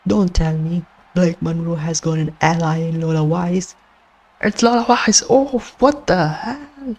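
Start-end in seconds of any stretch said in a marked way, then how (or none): 4.4-7.0 stunned play-by-play call, pitch jumping up; shocked commentator. Real, not acted. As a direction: call it like the match just got crashed.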